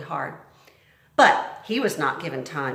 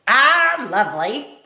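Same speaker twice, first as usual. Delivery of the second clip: loud, high mock cheer; bossy cook mocking the girl; sarcastic cook; 7 semitones higher, 9 dB louder, slower than usual. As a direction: drip with sarcasm at the top of your voice. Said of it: "said Cook".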